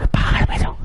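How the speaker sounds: hushed, voiceless order to get out; whispered dismissal. Acted. Teacher telling the three students to go, in a whisper. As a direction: whisper it as an echoing send-off.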